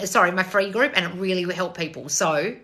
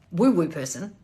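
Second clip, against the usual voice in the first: ordinary delivery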